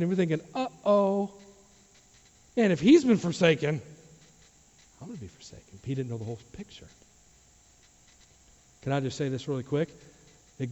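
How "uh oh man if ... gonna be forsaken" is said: acted uh-oh, sinking to near silence; dawning dread. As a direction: let worry shrink your voice to a murmur. Acts thief's worried thought.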